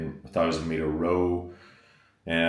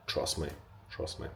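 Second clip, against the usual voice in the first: hushed coaxing; 3 semitones lower, 12 dB quieter than usual. Acted. Coaxing viewers about odd meal.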